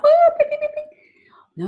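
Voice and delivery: high whoop then bell pings fading to a whisper; playful warning siren; alarm bell woo. Shown as a demonstration. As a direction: sound the alarm then let it die away. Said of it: "Little red bell going off".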